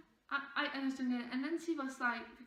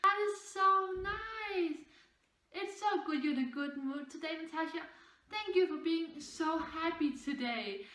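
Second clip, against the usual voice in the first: sarcastic singsong; 3 semitones higher than usual. Retold or imitated. Teacher taunting a student in sarcastic singsong. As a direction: sing the fake compliment mockingly. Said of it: "she started yelling at me".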